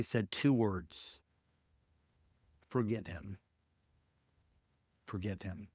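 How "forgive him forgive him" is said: lower, much softer, fading to near nothing; God's quiet voice telling her to forgive, lower and very soft; gentle inner voice. Retold or imitated. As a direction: breathe it like a still small voice.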